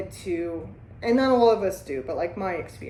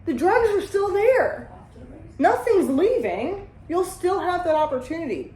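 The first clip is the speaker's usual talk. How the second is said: higher excited pitch to a newcomer; animated persuasion; 8 semitones higher, 3 dB louder than usual. Retold or imitated. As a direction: lean in and sell it. Pitching recovery to a user.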